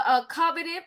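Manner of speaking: usual speaking voice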